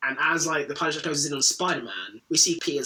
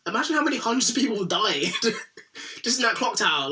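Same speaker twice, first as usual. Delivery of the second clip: ordinary talk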